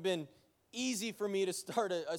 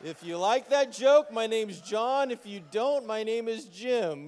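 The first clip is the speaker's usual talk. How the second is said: louder, slowed-down punchline; punchline shout; 4 semitones higher, 7 dB louder, slower than usual. Acted. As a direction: land the last joke big for the room. Punchline tag shouted to congregation.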